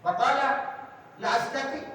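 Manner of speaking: man refusing. Retold or imitated. Man refusing to eat with right hand, high stubborn voice. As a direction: voice the man's stubborn "I can't". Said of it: "Kata orang itu".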